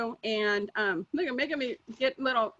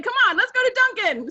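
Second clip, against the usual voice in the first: eager Mark; 8 semitones higher, 3 dB louder than usual. Retold or imitated. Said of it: "He's like".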